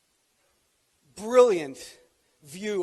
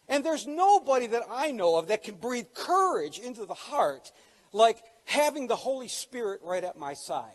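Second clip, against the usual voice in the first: loud, soaring preacher; fervent pulpit delivery; preaching; 4 semitones higher, 5 dB louder than usual. Acted. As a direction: proclaim it to the church. Preaching climax.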